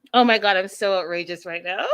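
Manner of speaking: ordinary delivery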